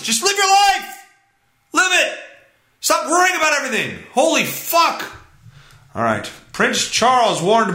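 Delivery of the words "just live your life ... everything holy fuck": high, strained yelling; exasperated outburst. Real, not acted. Exasperated rant at activists.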